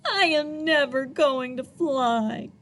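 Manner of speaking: a touch of a mock cry; gently dejected little penguin; lightly voiced disappointment; sad. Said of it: "he cried".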